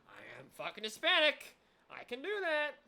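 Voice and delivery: excited. Real, not acted. Excited claim of heritage.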